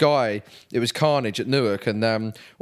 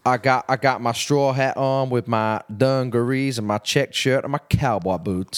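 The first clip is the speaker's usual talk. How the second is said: slow mock Southern cowboy drawl; put-on American accent. Guest describing his cowboy outfit, slow fake Southern US accent. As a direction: ham up a Texan accent, taking your time. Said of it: "that stupid accent".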